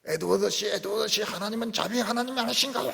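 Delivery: high, pleading imitation of a distraught elder; anguished elder. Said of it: "장로님도 막 뒹굴면서".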